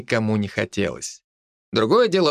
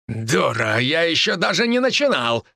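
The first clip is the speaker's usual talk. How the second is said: shouting; 3 semitones higher than usual. Acted. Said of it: "прокричал тот".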